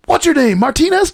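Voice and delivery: high-pitched mock fight commentary; Mero. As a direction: call out the name in a high teasing voice. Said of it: "Mero goes".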